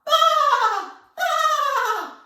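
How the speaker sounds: loud high bleating; sheep bleat. Shown as a demonstration. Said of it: "who all go".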